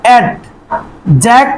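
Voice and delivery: Adjective very slowly, with pauses between its three parts; split into syllables. Shown as a demonstration. Teacher giving a trisyllable example, adjective in slow separate syllables. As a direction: break adjective into add, জেক, টিভ. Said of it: "তাহলে এখানে".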